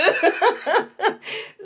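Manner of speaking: big high burst of laughter; loud laugh. Real, not acted. Laughing at her own story, loud. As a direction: let out a loud real laugh.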